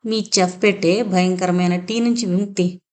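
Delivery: usual speaking voice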